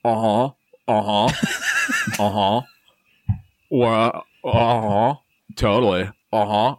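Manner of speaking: bored, absent uh-huhs; feigning interest. Acted. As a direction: pretend to listen, drifting off. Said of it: "feigning interest".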